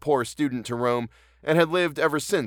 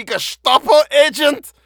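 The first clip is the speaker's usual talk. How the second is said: hammed-up German accent, much higher and louder, slow; bad German Nazi accent; 11 dB louder, slower than usual. Shown as a demonstration. Host mocks his own German accent, hammed-up German shout. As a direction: bark it like a cartoon German villain. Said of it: "without sounding like a bad stereotype Nazi".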